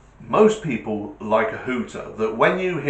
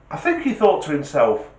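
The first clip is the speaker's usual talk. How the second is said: ordinary talk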